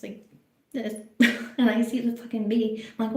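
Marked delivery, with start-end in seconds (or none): none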